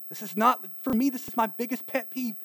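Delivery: normal talking voice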